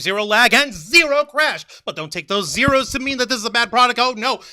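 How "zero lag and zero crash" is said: loud, slow, punchy pitch; hyped ad read. Acted. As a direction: sell it like a commercial.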